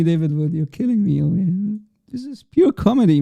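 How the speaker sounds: ordinary delivery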